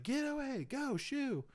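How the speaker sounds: high, retold yell at a charging dog. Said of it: "I'm yelling at it".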